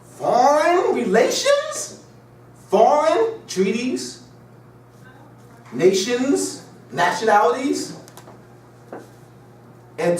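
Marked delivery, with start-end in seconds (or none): 0.0-8.0 drawn-out disbelief. Real, not acted. Mocking disbelief at scholar.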